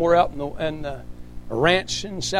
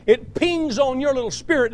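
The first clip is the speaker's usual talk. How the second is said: normal talking voice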